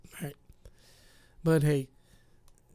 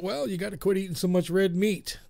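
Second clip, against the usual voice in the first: normal talking voice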